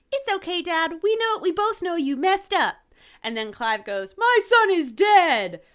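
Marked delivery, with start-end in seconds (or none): 0.1-2.7 put-on much higher child's voice, gentle; soothing boy caller. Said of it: "the caller goes". 4.2-5.6 much higher, louder, slow cry; anguished outburst. Retold or imitated. Host voiced in retold scene.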